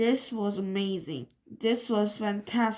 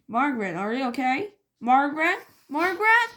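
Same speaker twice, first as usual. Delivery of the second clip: worried calling, rising; 5 semitones higher, 5 dB louder, much faster than usual. A narrator's reading.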